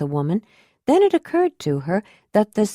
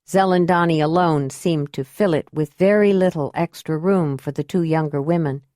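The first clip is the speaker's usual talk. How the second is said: plain reading voice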